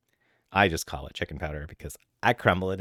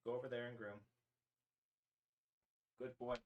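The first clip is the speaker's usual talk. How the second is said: soft, much higher cooing at the cats; pet talk; 6 semitones higher, 15 dB quieter than usual. Real, not acted. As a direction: coo to your pets softly. Cooing at cats while feeding them.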